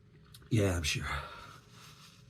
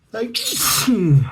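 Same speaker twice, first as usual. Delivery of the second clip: sneeze; 13 semitones higher, 13 dB louder than usual. Real, not acted. Sneezing while thanking.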